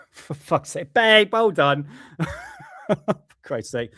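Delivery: high, laughing groan of disbelief; real laugh through the words; laughing exasperation. Real, not acted. Laughing at wife's camera mishap.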